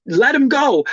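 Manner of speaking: high, insistent mock plea; exasperated daughter. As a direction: imitate a daughter urging release. Daughter urges mother to stop.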